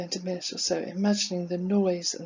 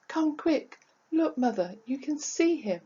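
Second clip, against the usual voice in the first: high, urgent calling voice; son calling out; 6 semitones higher than usual. Acted. Son urging mother to look, calling out. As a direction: beckon mother in a raised, higher pitch. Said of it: "shouting at me at the top of his voice".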